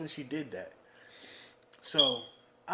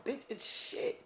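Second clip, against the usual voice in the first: exasperated; 9 semitones higher, 4 dB quieter, slower than usual. Acted. Exasperated rant at females.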